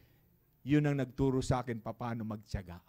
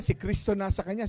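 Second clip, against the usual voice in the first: ordinary delivery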